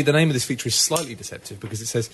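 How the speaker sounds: normal talking voice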